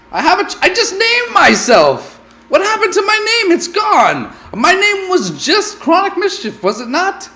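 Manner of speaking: high, loud disbelief at the lost name; shocked outcry. Real, not acted. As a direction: cry out in stunned outrage. Name suddenly vanished.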